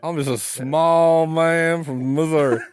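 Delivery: slow, drawling Southern voice; Southern accent. Retold or imitated. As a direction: drawl it like a humble country man. Southern accent bit continues.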